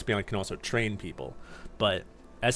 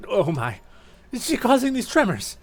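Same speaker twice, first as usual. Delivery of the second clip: much higher, alarmed little ghost voice; frightened character; 9 semitones higher, 4 dB louder than usual. Acted. Duskull startled by tremors, high alarmed voice. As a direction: yelp it as the ground shakes.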